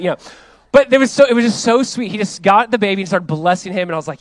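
ordinary talk, nothing marked

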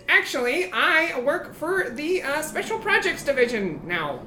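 high, measured little goblin voice. Acted. Goblin in a little suit declaring his new job, high voice. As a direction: pitch it high and speak with prim deliberation. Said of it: "And he says".

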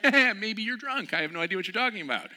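high, loud scoffing; mock incredulous. Mocking the friend's idea, high and loud scoffing.

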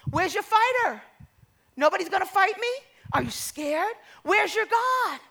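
Goliath's goading call; taunting. Acted. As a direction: jeer at a cowering army. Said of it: "he taunted them constantly".

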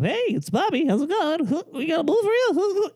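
bright pretend answering-machine greeting; voicemail greeting. Mock voicemail greeting to listeners, high and cheery. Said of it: "It's gonna go straight to voicemail".

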